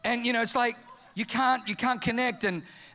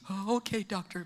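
meek patient; 3 semitones lower, 6 dB quieter than usual. Acted. Acted patient answering doctor.

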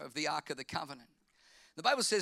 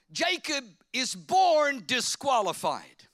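loud, slow, with full preacher force; preaching at full force; 6 semitones higher, 7 dB louder, slower than usual. Acted. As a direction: hammer it home from the pulpit. Preaching climax.

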